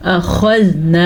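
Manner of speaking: usual speaking voice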